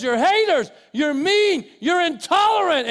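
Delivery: high, loud accusing cries; mock screaming accusations. Preacher voicing the world yelling at Christians, loud. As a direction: shout the charges like an angry mob. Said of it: "the darkness screams and yells and says".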